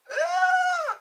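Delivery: high, loud imitated child's wail; mock kid scream. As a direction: shriek like a hurt child. Kid cries after getting hurt.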